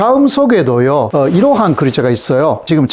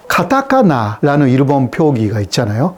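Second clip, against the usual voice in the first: ordinary talk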